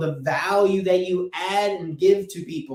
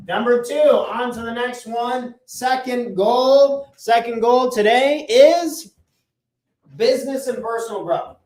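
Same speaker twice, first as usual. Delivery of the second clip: big announcement; 5 semitones higher, 6 dB louder than usual. Acted. Announcing next goal at board.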